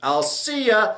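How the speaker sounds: high, loud, offhand goodbye; breezy Alicia impression. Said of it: "when Alicia was like".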